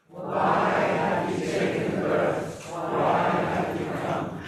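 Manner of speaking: slow breathy whisper of the question. Shown as a demonstration. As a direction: whisper it slowly to the circle. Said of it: "So we'll repeat that again".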